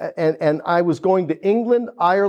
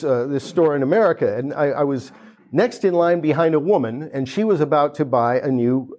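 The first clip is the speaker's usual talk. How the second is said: normal talking voice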